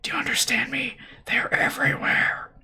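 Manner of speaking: rasping whispered warning; hissed whisper. A narrator's reading. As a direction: hiss it like a man on a respirator. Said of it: "he hissed".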